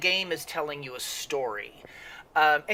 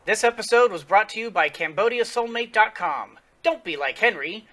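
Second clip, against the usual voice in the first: punchy, bright fake sponsor read, louder and higher; mock ad read; 6 semitones higher, 5 dB louder than usual. Host reads a joke sponsor ad to viewers, in a mock ad voice. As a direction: sell it like a cheesy sponsor spot.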